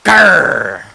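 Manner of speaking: loud hammed-up growl; a big cartoon growl of frustration; angry growl. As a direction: growl in mock rage. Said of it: "I'm so angry".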